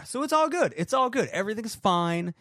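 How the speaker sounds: high, strained fake calm; forced cheer. Real, not acted. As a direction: insist all is well through the panic. Tech trouble, forced cheer.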